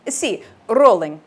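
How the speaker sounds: trilled R on rolling, Italian engineer; Italian accent. Acted. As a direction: roll the R like an Italian sound engineer. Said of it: "He trilled the R again".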